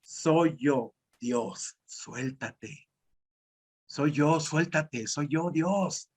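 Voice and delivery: God's low voice tells the man to let go. Acted. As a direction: speak as God, calm and deep. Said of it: "se escucha una voz que le dice".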